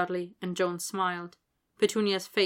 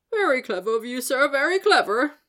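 higher, louder, eager little wizard gushing; bright flattery; 6 semitones higher, 7 dB louder than usual. Acted. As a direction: gush with delighted praise. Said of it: "Daedalus told Vernon brightly".

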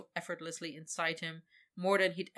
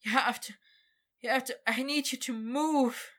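higher, louder urgent plea; desperate pleading; 6 semitones higher, 5 dB louder than usual. Acted. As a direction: beg with rising urgency. Victor pleads with dragon.

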